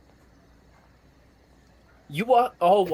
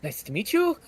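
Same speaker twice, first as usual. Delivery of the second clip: halfling voice; 7 semitones higher than usual. Acted. Librarian greets Gaston.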